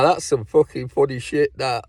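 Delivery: ordinary delivery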